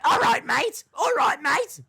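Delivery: loud, high mock Australian; [name]'s Australian accent. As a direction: shout it in an imitated Aussie accent. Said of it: "[name] doing an Australian accent".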